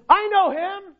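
high, lilting claim of faith; professing believer. Acted. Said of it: "If someone says".

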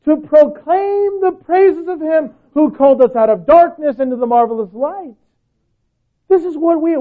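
high, loud preaching voice, rising to a shout; full-force preaching. Acted. Pastor urging the church to want God, loud preaching. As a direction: preach it hard to the crowd.

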